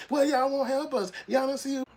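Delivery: very high, whiny mock plea; mock begging. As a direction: beg in a shrill put-on voice.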